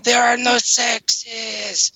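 high, slow monotone moaning; ghost voice. Pretend. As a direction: drone it out like a preachy spook. Mock ghost on a recording.

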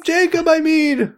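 very high squeaky cartoon voice.

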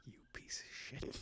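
hushed aside; whispering. Real, not acted. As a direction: whisper it off mic. Whispered aside near the mic.

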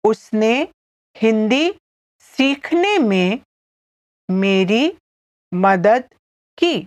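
slow word-by-word model sentence; each word set apart by pauses; said slowly. Shown as a demonstration. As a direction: leave long gaps for learners to follow. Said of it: "I'll say it again slowly".

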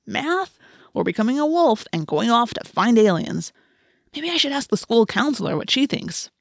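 high, swooping mock pondering; sarcastic deliberation. A narrator's reading. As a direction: pretend to agonize over an obvious choice. Marco mock-weighs absurd choices.

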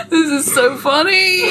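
high voice, nearly crying with laughter; tearful laughter. Real, not acted. Reader recovering from a laughing fit, tearfully amused. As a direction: squeeze the words out through real giggles. Still cracking up at the excerpt.

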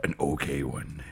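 very low, hushed impression voice; deep, quiet movie-villain impersonation; Tony Todd. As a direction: drop right down deep and soft. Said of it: "fairly decent Tony Todd impression".

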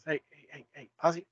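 quietly trying to get someone's attention; Kermit. Acted. Host acts the frog prompting his castmate, hushed. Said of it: "like Kermit whispers".

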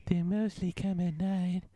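movie quote. Acted. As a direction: quote the movie girl eerily.

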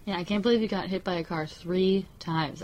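ordinary talk

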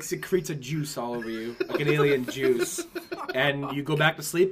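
ordinary talk

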